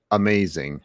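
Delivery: ordinary delivery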